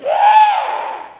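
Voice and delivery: very high, loud yell; high-pitched full-volume shriek; boy's shrieking roar. Acted. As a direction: screech back at the werewolf. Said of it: "And then Joey went".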